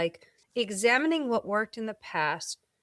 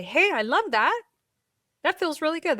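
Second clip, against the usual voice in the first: lightly voiced body, higher and cheery; happy body voice; 8 semitones higher, 4 dB louder, faster than usual. Body praising a Pilates move, light cheery voice. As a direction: let the body cheer you on. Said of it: "your body's also gonna say".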